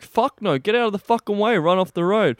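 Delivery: loud, high-pitched yell of alarm; urgent warning. Retold or imitated. Man in the road as a car comes, urgent shout. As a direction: yell to clear the road at once.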